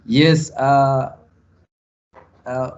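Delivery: normal talking voice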